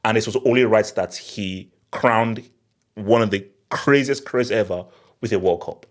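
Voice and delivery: ordinary delivery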